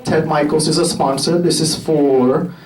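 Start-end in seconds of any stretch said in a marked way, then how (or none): none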